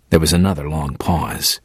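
ordinary delivery

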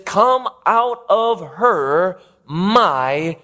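very slow, drawn-out plea; pleading. Acted. Preacher voices God pleading with his people, slow drawn-out appeal. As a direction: plead word by word, stretching each one. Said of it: "And so God pleads, he says".

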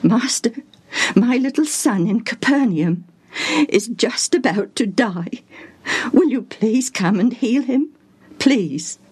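high, pleading father; acted desperate, partly whispery plea; quivering with emotion. A narrator's reading. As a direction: beg for help with a trembling voice. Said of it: "his voice quivering with emotion".

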